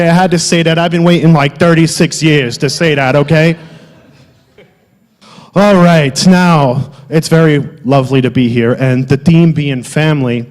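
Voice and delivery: ordinary delivery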